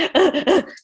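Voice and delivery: a high, loud shocked gasp; sharp gasp. Retold or imitated. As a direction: gasp in alarm. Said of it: "I'm like".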